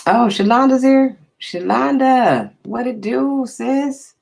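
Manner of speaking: high, lively hello to a friend; light up as she walks in; delighted welcome to Shalonda; excited greeting. Real, not acted. Greets friend arriving in chat.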